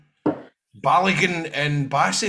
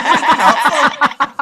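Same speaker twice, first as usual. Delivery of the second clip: much higher and louder through laughter; laughing mock outrage; 10 semitones higher, 10 dB louder than usual. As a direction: shout the disbelief while laughing. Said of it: "I was like".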